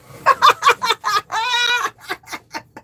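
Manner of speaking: high, loud run of laughter; real cackling laugh. Real, not acted. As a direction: burst into a long, high cackle. Laughing at own insane-asylum joke.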